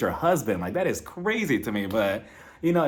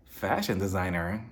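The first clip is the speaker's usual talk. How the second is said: ordinary talk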